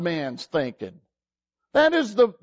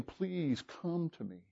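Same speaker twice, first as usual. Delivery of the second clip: tender plea; 3 semitones lower, 16 dB quieter than usual. Retold or imitated. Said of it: "And he's saying".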